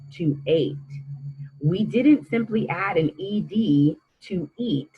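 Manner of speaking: ordinary delivery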